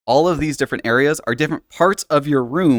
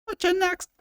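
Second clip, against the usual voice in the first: Irish accent; 6 dB quieter than usual. Shown as a demonstration. Keeps accent.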